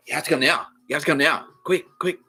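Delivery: mimicking a friend's pushy, repeated urging; urgent summons. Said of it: "It's emergency".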